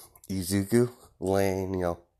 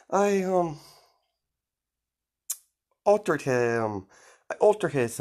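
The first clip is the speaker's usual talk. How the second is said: gleeful villainess; 9 semitones higher than usual. Retold or imitated. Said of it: "And he's like".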